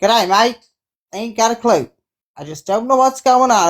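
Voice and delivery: put-on Aussie accent; mock Australian, punchy "G'day, mate". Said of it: "Australian accent, is it?".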